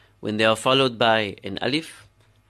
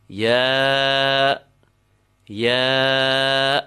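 long-held, level Arabic letter name; letter recitation; 4 dB louder than usual. Shown as a demonstration. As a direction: draw out ya on one even note.

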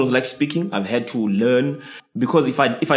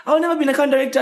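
high, fast put-on twang; mock twangy accent; 13 semitones higher, 3 dB louder, faster than usual. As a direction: mimic a posh twang, much higher and faster. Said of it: "I was like".

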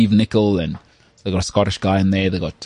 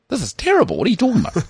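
much higher, incredulous retort; mock disbelief; 9 semitones higher, much faster than usual. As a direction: protest the compliment in a high voice. Retold disbelief at praise of accent.

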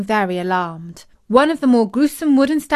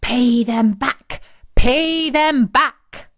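slow, eerie chant; drawn-out spooky refrain, building higher and louder; chorus of masks; 4 semitones higher, 4 dB louder, much slower than usual. A narrator's reading. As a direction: chant it like haunted masks closing in. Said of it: "the masks joined in, saying".

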